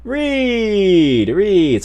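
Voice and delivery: high, drawn-out name call; excited shout-out. Real, not acted. Calls out the corrected subscriber name.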